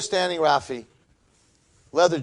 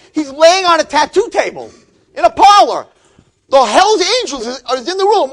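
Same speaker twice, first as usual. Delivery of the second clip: high and loud, reliving it for the class; excited retelling; 13 semitones higher, 13 dB louder than usual. Retold or imitated. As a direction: pump the story up to your students, loud and high.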